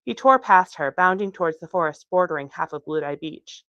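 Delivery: ordinary delivery, nothing marked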